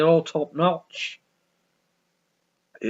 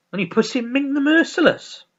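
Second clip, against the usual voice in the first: usual speaking voice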